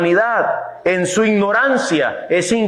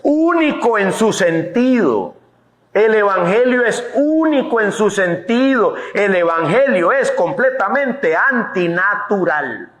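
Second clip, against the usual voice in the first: fervent sermon voice, raised pitch; preaching; 5 semitones higher than usual. Acted. Pastor preaching to the church, fervent. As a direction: press the point home to the congregation.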